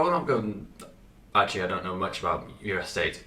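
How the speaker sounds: ordinary talk, nothing marked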